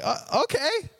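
high, awkward comic okay; taken aback. Retold or imitated. Son-in-law told he sounds hickish, high taken-aback okay. Reacts to being called hickish.

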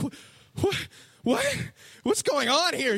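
high-pitched comic disbelief; jumpy, high confused stammer; mock bafflement. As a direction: squeak it out, totally thrown. Jonah baffled by their repenting.

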